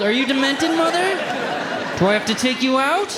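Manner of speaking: high, laughing mock outrage; mock exasperated son. Son mock-scolding his forgetful mother, high and laughing. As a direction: retell snapping at mom, laughing.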